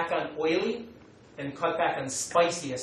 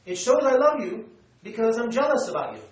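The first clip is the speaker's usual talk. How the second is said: put-on French girlfriend; 5 semitones higher, 5 dB louder than usual. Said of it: "she said".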